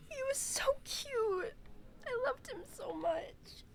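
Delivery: high, slow tearful squeak fading to breath; crying. Real, not acted. Streamer remembering lost pet son, crying. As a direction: choke it out slowly through tears. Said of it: "I'm actually crying".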